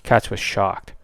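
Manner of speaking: ordinary delivery, nothing marked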